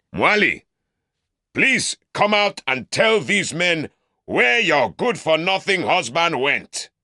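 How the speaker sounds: mock the Southerner for the mob; scornful shout. Uncle calling loudly to Mwali.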